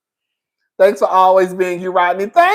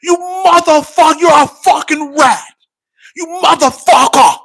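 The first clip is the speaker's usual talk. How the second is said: higher, louder, furious yelling; screaming insults; 8 semitones higher, 4 dB louder than usual. Retold or imitated. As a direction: yell it in a fistfight. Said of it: "They was gonna call each other".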